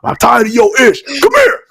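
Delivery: playful retold taunt, voice jumping way up; mock angry challenge. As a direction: dare him to come over. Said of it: "He's hyped about it, pissed off".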